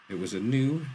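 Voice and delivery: usual speaking voice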